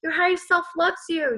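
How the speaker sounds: ordinary delivery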